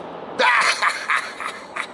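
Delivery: high breathy laugh; burst of laughter. Real, not acted. Boss laughs at the engineer's whiskey answer, high laugh. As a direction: crack up at the answer.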